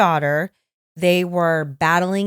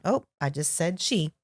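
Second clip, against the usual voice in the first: ordinary delivery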